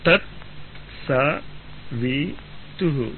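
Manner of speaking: slow, each syllable separate: తత్ స వి తుహు; spelled out slowly, syllable by syllable. Shown as a demonstration. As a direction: break తత్సవితు into separate syllables.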